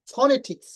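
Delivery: "ফনেটিক্স" with a breathy aspirated ph at the start; correct pronunciation. Shown as a demonstration. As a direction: stress the opening puff of air in "ফনেটিক্স". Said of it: "এটা উচ্চারণ হবে ফনেটিক্স". ("ফনেটিক্স" শুরুতে ফ ধ্বনি দিয়ে)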